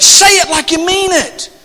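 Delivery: high, loud pulpit exhortation; building to a shouted affirmation; fervent preaching. Real, not acted. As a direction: drive it home to the congregation. Preaches at full force.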